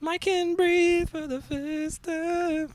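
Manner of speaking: very high, slow dramatic sigh of relief; theatrical high breathless relief; mock relief. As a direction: ham up the relief. Relief at being back.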